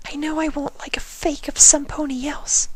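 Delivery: high, breathy whisper of a timid pony; gentle, shy, barely daring to ask; Fluttershy's voice. A narrator's reading. Fluttershy insists.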